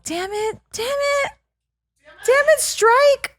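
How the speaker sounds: high, slow squealed curse; mock frustration. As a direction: whine it like a cartoon villain foiled. Playful frustrated outburst.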